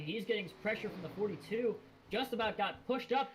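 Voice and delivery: rising urgency as the cars close up; building excitement. Real, not acted. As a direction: race call climbing steadily in pitch. Race call as a block develops.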